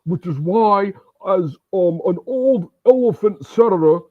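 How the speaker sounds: put-on rally the crowd as a high, strained old socialist senator; a hammed-up Bernie impression, high and fiery; Bernie Sanders. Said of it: "I try to do a Bernie impression".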